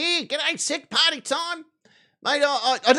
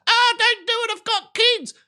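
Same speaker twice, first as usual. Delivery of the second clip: a light, higher pleading voice; mock pleading lady; 11 semitones higher, 3 dB louder than usual. Arrested lady begging the officer, lightly mimicked and pleading. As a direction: beg like a frightened mum.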